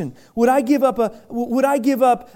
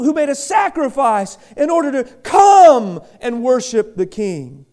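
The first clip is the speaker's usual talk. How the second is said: preaching at full force; 7 semitones higher than usual. Acted. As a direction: drive the point home to the congregation. Preaching about the Magi.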